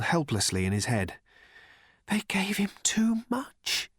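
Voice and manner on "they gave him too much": high, slow, whispered whimper; tiny whimper. Acted. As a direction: whimper it out, barely there. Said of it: "in a tiny whimper".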